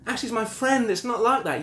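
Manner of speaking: high-pitched mimicry of her protest; wife denying it. Husband mimicking his wife's denial of an affair, scornful. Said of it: "she denied it".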